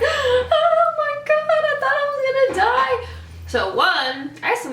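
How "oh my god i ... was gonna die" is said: very high, slow, loud sobbing wail; replayed tearful, frightened wail; crying in fright. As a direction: wail it through tears of shock. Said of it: "like, crying".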